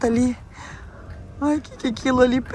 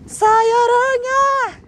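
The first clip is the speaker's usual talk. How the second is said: high, loud drawn-out shout; shooing shout; 10 semitones higher, 6 dB louder, much slower than usual. Shown as a demonstration. Girl shows viewers how she will chase the spider, shooing shout. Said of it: "que eu faço assim, ó".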